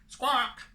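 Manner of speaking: high loud squawk; hen's shrill squawk. Acted. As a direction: squawk like a startled hen. Imitating the hen.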